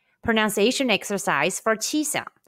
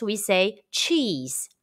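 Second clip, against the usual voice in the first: slow, drawn-out cheese; slowly, as if teaching mouth shape; slow demo; much slower than usual. Shown as a demonstration. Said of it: "just like when taking photos".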